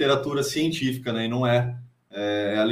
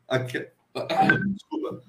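choking and coughing; words caught in a choke; 3 dB quieter than usual. Real, not acted. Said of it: "engasguei aqui".

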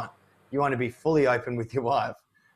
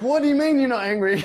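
higher and louder, mock disbelief; half-laughing disbelieving cry; incredulous; 13 semitones higher, 6 dB louder, faster than usual. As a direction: play up the shock. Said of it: "and I'm like".

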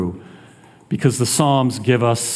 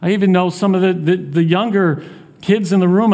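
ordinary talk

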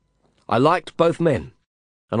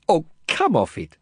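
snapped; 5 semitones higher than usual. A narrator's reading. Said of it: "she snapped".

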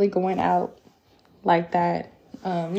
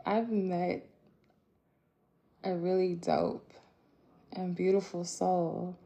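ordinary delivery

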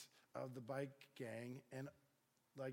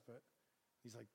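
ordinary talk

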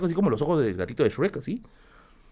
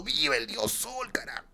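retold shout; 11 semitones higher, 8 dB quieter than usual. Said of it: "mi pata gritando".

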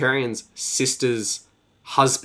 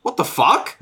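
much higher, louder mock shock; shocked dad; 11 semitones higher, 5 dB louder, slower than usual. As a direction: gasp it out as the appalled father. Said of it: "And her dad is like".

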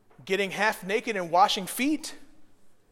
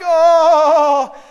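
higher, loud swooning oh; mock lovestruck sigh; 9 semitones higher, 17 dB louder than usual. Pastor imitating a dreamy young couple, loud swooning oh.

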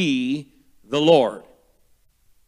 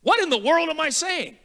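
sudden self-question; 12 semitones higher than usual. Retold or imitated. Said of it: "And then I realized one day".

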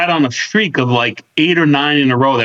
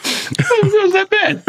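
squealing laugh-cry; much slower than usual. Real, not acted. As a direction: laugh till it cracks into crying. Laughing, crying from disgust.

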